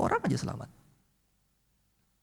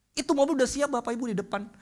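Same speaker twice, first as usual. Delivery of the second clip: ordinary talk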